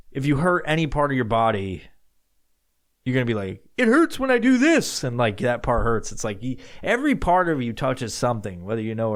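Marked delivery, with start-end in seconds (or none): 3.8-5.0 high, louder whiny complaint; high whiny mock complaint. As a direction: whine it like a sore patient. Complaining about hurt body part.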